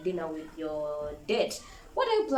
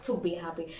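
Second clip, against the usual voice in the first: usual speaking voice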